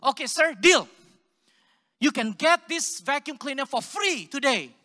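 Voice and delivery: loud, pushy mock salesman; eager sales pitch. Salesman sells the vacuum to the trailer guy, loud and eager. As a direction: close the deal with a big push. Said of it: "I said".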